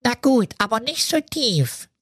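nose held. Acted. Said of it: "hielt sich die Nase zu".

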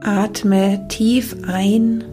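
ordinary talk

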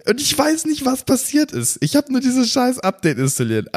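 high, disbelieving outburst; can't believe it, voice jumping up; exasperated disbelief. Real, not acted. Rant about broken update.

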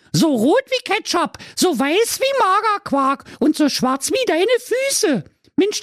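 very high, pinched Berlin housewife; Berlin wife voice. Acted. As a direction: squeak it out like a cheeky Berliner Göre. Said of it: "Doch die Waltraud erwiderte". (hohe, schrille Berliner Ehefrau)

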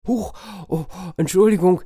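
high, surprised yelp then apology; startled apology. Acted. Foresters after the bear's blow, startled. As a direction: flinch and apologise. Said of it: "sagten die Förster".

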